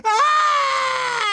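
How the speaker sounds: long, very high shriek; piercing, held scream; throat-cut scream. A narrator's reading. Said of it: "having her throat cut".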